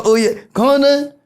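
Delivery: high, teasing calm down; mock gym instructor. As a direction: coo it in mockery. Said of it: "Then they go".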